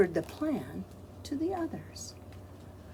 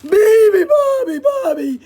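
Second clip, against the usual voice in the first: loud high yell; 13 semitones higher, 16 dB louder than usual. Acted. Hippos yell the song. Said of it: "yelled the hippos".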